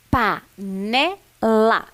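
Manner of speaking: "Panela" in three separate beats, very slow with pauses; counting syllables. Shown as a demonstration. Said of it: "Vamos contar?". (contando as sílabas, pausadamente)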